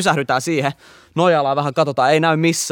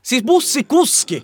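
high, slow emphatic exclamation; incredulous exclamation; 9 semitones higher, slower than usual. Real, not acted. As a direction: stretch it out in disbelief, pitched way up. Insists on the incredible detail.

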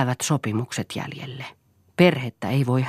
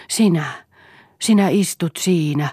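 higher, louder, halting intensity; rage mixed with tenderness; 5 semitones higher, 6 dB louder than usual. A narrator's reading. Eero confronting Lea, rage mixed with tenderness. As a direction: let suppressed rage and affection mingle. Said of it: "Eeron ääni värähteli raivosta, johon sekoittui hellyyttä".

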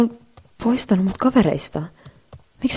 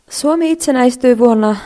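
louder, higher, forced presenting voice; nervous presentation start; 5 semitones higher, 8 dB louder than usual. Acted. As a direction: push through the nerves. (jännittynyt esitelmän aloitus)

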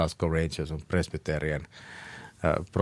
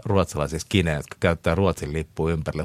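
usual speaking voice

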